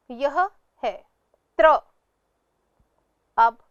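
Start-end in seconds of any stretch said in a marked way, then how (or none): 1.6-1.8 "त्र" as त and र blended into one syllable; conjunct pronunciation. Shown as a demonstration. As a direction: say "त्र" on its own, त running straight into र. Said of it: "तो इसका उच्चारण". ("त्र" में त और र मिलाकर उच्चारण)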